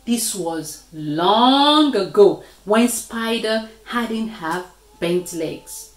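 ordinary delivery, nothing marked

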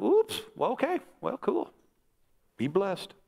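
high-pitched, mock-cheery backing away; awkward polite escape. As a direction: squeak out a hasty, awkward goodbye. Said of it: "You just wanna go".

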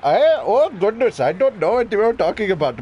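put-on Indian accent; playful, confident Indian-accent demo. Shown as a demonstration. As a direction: demonstrate an Indian accent for friends. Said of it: "this is how you do a proper Indian accent".

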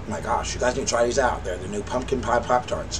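talking while chewing; muffled by food in mouth; mouth full. Real, not acted. Seen 1: Bites pastry at 1.8-3.8, chews with full mouth throughout.

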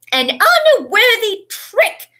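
loud, high, drawn-out outrage; furious shout. A narrator's reading. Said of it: "she was shouting".

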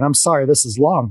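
usual speaking voice

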